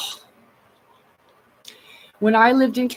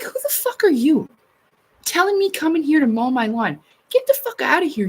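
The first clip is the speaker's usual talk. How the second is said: higher, sharp retold rant; indignant yell, replayed with a higher pitch; telling him off; 7 semitones higher than usual. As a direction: chew out the homeowners association guy. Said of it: "I told him off".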